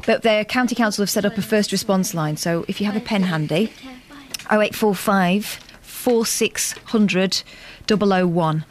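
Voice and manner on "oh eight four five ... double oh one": slow and clear. Shown as a demonstration. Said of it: "just wanna be absolutely clear".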